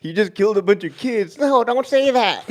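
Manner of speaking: loud, high-pitched mock panic; hammed-up squeal of horror, stretched at the end; squad's put-on voice. Squad reacting to Hill's grenade near a school, high mock voice. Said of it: "Why did you just do that voice?".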